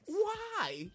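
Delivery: very high, incredulous squeal of protest; hammed-up bewildered protest; exasperated disbelief. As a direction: shoot way up in pitch, baffled. Baffled reaction to being insulted.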